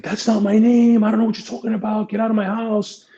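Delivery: panicked denial. Retold or imitated. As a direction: deny it in a panic, voice jumping up. Suspect panics when called kunya.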